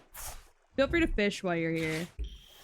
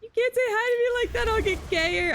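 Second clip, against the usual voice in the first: higher, fast, playful fluster; hammed-up high-pitched fluster; mock flustered squeal; 13 semitones higher, 3 dB louder than usual. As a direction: squeak it out, teasing. Mock flustered reply to chat.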